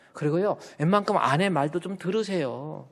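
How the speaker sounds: usual speaking voice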